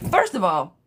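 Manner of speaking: normal talking voice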